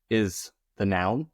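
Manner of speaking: normal talking voice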